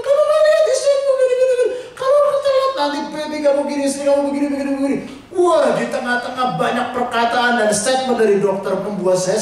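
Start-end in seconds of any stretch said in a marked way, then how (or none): 0.0-5.0 high, rapid mock tirade; scolding doctor. Doctor warning the husband about wife and baby, fast high mock scolding. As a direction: rattle off a hammed-up scolding, pitched way up. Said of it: "Dia marahin saya".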